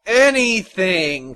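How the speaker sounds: loud, drawn-out exasperation; exasperated cry. A narrator's reading. Despairing tailor answers his son with an exasperated cry. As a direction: cry it slowly, at wit's end. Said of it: "cried the tailor, his eyes rolling".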